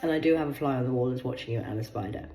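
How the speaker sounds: ordinary talk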